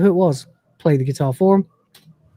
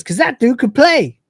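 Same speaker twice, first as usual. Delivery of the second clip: Cat's retort; 8 semitones higher than usual. Acted. Cat's retort in retold scene.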